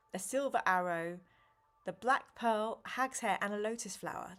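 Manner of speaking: usual speaking voice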